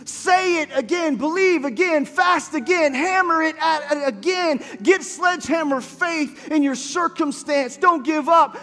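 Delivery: rising, urgent preacher's exhortation over music; preaching at full force. Acted. Preacher urging the congregation to keep praying, in a rising, fervent exhortation. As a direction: build the call to pray again to a shout.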